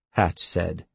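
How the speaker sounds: ordinary delivery, nothing marked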